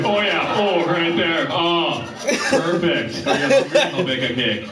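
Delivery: hammed-up cartoonish Minnesota accent; mock ecstasy in a chirpy Minnesota accent; goofy Minnesota accent. Imagined bedroom talk from the show booker, in a goofy Minnesota accent. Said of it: "Everybody had the same goofy accent".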